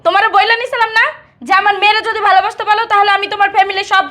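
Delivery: heated, high-pitched protest; indignant reproach. Acted. Reminding a spouse of the promise to love the child, heated reproach. Couple quarrelling over marriage promises.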